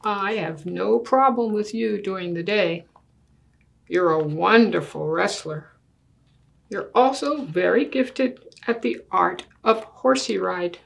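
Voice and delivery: put-on lively storybook read in a child's earnest voice; reading to children. Child argues with Daddy over the big bed, earnest storybook reading. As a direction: read it like a kid making a serious case. Reads picture book aloud.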